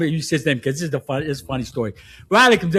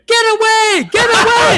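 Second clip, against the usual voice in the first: high, loud frantic scream; 8 dB louder, slower than usual. Retold or imitated. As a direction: yell it like a panicked kid. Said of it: "He starts screaming".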